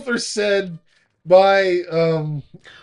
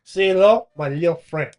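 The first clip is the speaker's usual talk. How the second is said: Scarface quote; 8 dB louder than usual. Retold or imitated. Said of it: "quoting Scarface".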